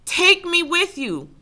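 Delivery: very high, loud, drawn-out cry; screamed plea. A narrator's reading. One left in the dark room begging to escape, screamed plea. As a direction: cry it out slowly, pitched far up. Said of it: "and words are screamed".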